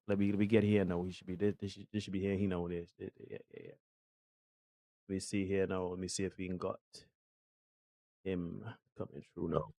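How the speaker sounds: low, very soft aside; muttering to self. Real, not acted. Host trying to bring the co-host through, muttering quietly to himself. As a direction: talk under your breath while busy.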